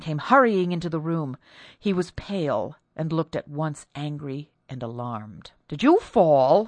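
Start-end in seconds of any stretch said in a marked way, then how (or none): 5.7-6.7 loud, sharp question; startled demand. Acted. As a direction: bark it out, alarmed. Startled husband calls out.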